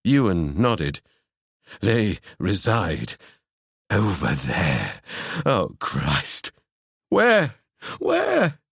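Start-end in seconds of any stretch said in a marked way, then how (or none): 1.8-6.5 low, slow, fearful voice; fearful murmur. Acted. Ewan pointing Seb to where they are, low and fearful. 7.1-8.6 high, urgent questions. Acted. Seb urgently asking Ewan where, very high.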